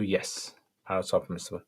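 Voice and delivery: normal talking voice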